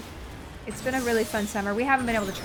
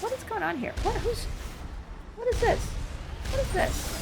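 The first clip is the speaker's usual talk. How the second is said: surprised; 11 semitones higher, 6 dB quieter than usual. Real, not acted.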